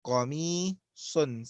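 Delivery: قميص said slowly, q from the back of the throat; drawn out قميص for the class to repeat; slow model word. Shown as a demonstration. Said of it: "Say that, everyone".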